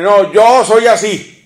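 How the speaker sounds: stubborn man. Retold or imitated. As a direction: play the man who won't change. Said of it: "Los hombres somos así". (hombre terco, en burla, más alto y fuerte)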